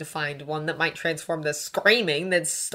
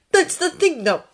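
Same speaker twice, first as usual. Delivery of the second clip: loud, raised interjection; interrupting; 12 semitones higher, 8 dB louder than usual. A narrator's reading. Draco interrupting Hermione, heated. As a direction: cut in firmly. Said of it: "Draco interrupted".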